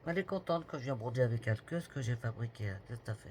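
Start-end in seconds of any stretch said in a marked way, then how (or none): none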